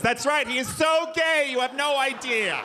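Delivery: high mocking girlfriend voice; lightly voiced gossiping ex; ex-girlfriend impression. Ex-girlfriend mocking him to a crowd, high and teasing. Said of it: "like one of my ex-girlfriends".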